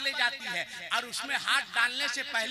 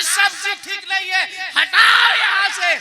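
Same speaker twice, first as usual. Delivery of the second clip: high, loud, slow furious shout; angry shout; 8 semitones higher, 15 dB louder than usual. Acted. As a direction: bark the order away. Father-in-law complains about the food. (गुस्से में ऊँची चीख)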